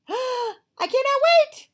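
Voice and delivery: high, loud, slow gasp of delight; excited gasp. Acted. Piggy cannot wait for Gerald's surprise, high eager.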